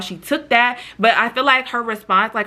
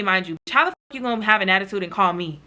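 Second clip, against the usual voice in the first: usual speaking voice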